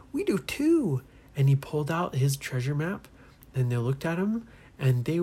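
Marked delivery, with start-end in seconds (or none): 0.1-1.0 high-pitched, delighted reply; excited discovery. Acted. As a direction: pipe up excitedly, voice leaping high. Friend excitedly answers about map.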